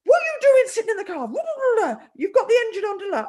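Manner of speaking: put-on high, loud scolding of an angry older man; mimicked shouting. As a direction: imitate someone yelling through a car window. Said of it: "shouting at me".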